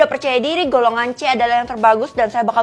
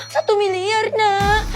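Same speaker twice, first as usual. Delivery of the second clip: higher, whimpering fake cry; mock sob; 6 semitones higher, much slower than usual. Mother fake-sobbing to her child about money. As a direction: pretend to weep over the loss.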